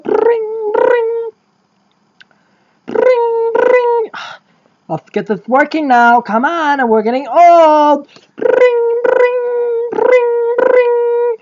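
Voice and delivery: very high mock phone ringing; a silly voiced ring tone. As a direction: chirp it like an old telephone.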